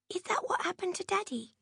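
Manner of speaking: put-on a small girl asking the hardest question; innocent child's question. A narrator's reading. Jenny asks her mother about Daddy, high innocent child's voice.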